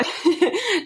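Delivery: bright little laugh burst; short laugh. Real, not acted. Host laughing at the coined word, short laugh.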